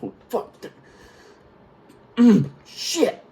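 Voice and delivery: high, strained cursing after being winded; getting breath back. Retold or imitated. Winded sailor after chief pops his chest, straining. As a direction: imitate groaning out curses on returning breath. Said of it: "I get my breath back".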